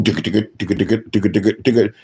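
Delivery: low ticka ticka clicks; ticking eye sound. Retold or imitated. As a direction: mimic the wandering eye ticking. Eye moving sound effect.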